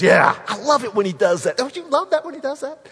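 loud whoop climbing to high pitch; mock gleeful fan, hammed up; cocky cheering. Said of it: "There wasn't any cockiness".

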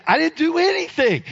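high, insistent comic protest; exasperated high-pitched plea; indignant denial. Retold or imitated. Retold protest of innocence to firemen.

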